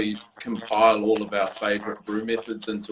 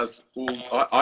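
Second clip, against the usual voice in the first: ordinary delivery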